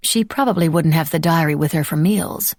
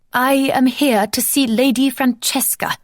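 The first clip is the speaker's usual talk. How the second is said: high, slow, badly put-on posh English accent; a clumsy fake English accent to fool the butler; terrible English accent; 7 semitones higher than usual. American lady faking a terrible English accent for the butler. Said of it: "she said in her best English accent, which was terrible".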